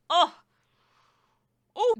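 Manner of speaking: sudden loud, thrilled "oh!"; excited outburst. Real, not acted. Reviewer hearing the song's boom kick in, a loud thrilled outcry. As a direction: burst out as the song explodes into the boom.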